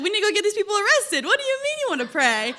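high, fast, incredulous protest; indignant disbelief. Retold or imitated. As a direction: push back in disbelief. Retelling arguing with dad.

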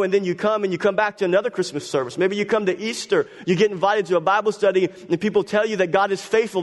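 ordinary talk, nothing marked

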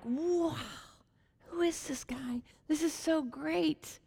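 soft breathy wonder, slow at the end; awed whisper. Retold or imitated. Townspeople marvel at Jesus in an awed whisper. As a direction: marvel under your breath.

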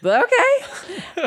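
very high, giggling agreement; laughing okay. Retold or imitated. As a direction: laugh out a yes. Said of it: "he's like".

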